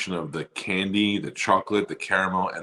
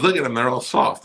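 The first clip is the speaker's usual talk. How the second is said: high, louder nagging wife; 6 semitones higher, 6 dB louder than usual. Retold or imitated. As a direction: imitate the wife complaining. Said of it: "She goes".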